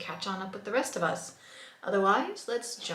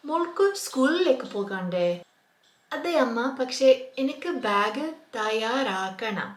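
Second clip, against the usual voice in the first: acted mother and child; 4 dB louder, much faster than usual. Teacher voicing a mother asking her daughter about school.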